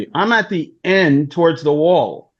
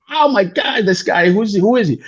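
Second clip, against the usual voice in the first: mock shocked crowd; 5 semitones higher than usual.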